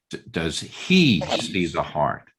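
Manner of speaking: low question leaning on "he"; asking who it is that sees; stress on he. Shown as a demonstration. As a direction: hit "he" and let the question fall. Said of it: "upon which word you place the emphasis".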